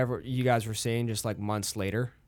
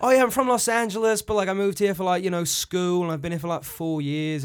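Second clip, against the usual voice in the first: partygoer explaining, higher; 8 semitones higher, 5 dB louder than usual. Retold or imitated. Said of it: "It's like".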